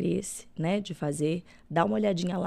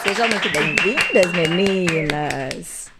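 louder, drawn-out welcome to guests; 9 dB louder, slower than usual. Real, not acted. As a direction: call out the welcome with energy. Host welcomes guests loudly. (boas-vindas em voz alta e arrastada)

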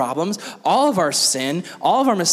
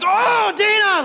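loud, high mock-exasperated groan; inward exasperation; 4 dB louder, much slower than usual. Counselor fed up with the kid, hammed-up exasperated cry. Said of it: "Like, in my mind".

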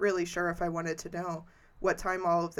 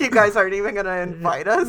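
mock offended; 13 dB louder, faster than usual.

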